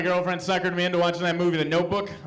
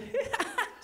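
high, softer mock maniac giggle; imitating a manic laugh; 7 dB quieter than usual. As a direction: cackle like she's lost it. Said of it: "starts laughing like a maniac".

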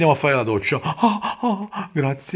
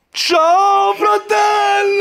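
high, drawn-out shouted goodbye; gloating shout; 13 semitones higher, 4 dB louder, much slower than usual. Real, not acted. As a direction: shout a slow, mocking farewell. Father gloats at game win.

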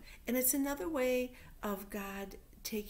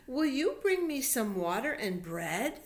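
louder, higher weary request; Elijah asking; 6 semitones higher, 6 dB louder than usual. Acted. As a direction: ask the lady politely but urgently. Elijah asks the lady.

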